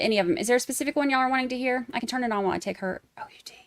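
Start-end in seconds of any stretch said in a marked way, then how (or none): 3.2-3.5 fast hushed whisper. Real, not acted. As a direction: whisper quickly so she won't notice. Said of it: "when I spelled it".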